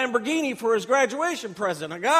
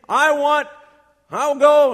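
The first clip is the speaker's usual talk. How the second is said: eager volunteering; 4 semitones higher, 11 dB louder than usual. Retold or imitated. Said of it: "And he's saying".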